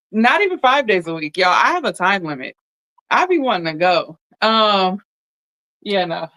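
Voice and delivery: pushing words out while crying; crying but still talking; tearful. Real, not acted. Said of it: "I mean, I'm already crying".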